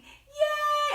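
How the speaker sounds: squealed high cheer; excited cheer. Acted. Cheer after the greeting song.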